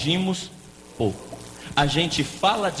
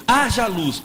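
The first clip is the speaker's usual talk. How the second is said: higher-pitched commanding declaration as God; God's command; 6 semitones higher, 4 dB louder, slower than usual. Acted. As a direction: proclaim it as God creating light. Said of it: "Disse Deus".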